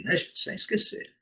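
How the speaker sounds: ordinary delivery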